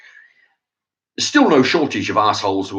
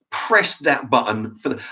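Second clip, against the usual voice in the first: ordinary delivery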